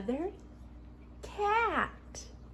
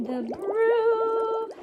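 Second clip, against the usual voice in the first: high loud brew; 9 semitones higher, 8 dB louder than usual. Pretend.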